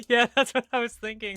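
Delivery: amused laugh while agreeing. Real, not acted. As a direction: giggle it out. Laughing at the mix-up.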